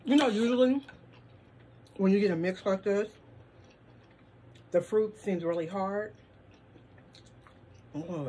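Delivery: speaking between bites; chewing while talking. Real, not acted. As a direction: mouth partly full. Seen 3: Mango put in mouth at -1; talks with full mouth, keeps eating.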